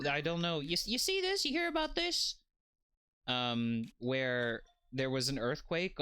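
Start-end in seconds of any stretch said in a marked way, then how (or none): 0.6-2.4 mock incredulous.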